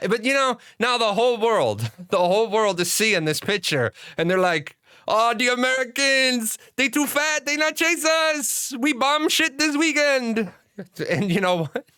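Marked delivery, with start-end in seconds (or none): 5.1-10.5 much higher, slow, broken-English mock voice; mock foreign enemy. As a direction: lampoon a gloating foreign enemy, pitched way up. Mocking how foreigners see Americans.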